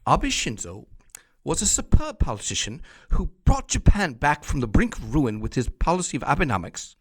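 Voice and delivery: hammed-up posh British newsreader, loud and high; mock English accent, booming praise; fake English accent. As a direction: put on an over-the-top English accent. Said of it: "in my fake English accent".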